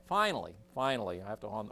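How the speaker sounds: ordinary talk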